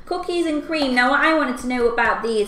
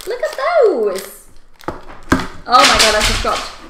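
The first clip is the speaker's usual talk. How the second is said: excited; 7 dB louder than usual. Real, not acted. Showing the spilled sweets.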